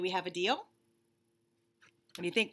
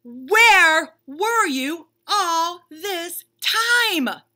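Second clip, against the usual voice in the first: much louder, higher, very slow, every word dragged; exasperated shout; 11 semitones higher, 14 dB louder, much slower than usual. Acted. Bunny shouting at the reader for being late, very slow and loud. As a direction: yell it out, totally fed up.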